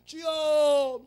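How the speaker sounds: very slow, loud, high drawn-out cry; hammed-up long prayer wail; mock prayer wail. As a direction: stretch the Lord into a long wail.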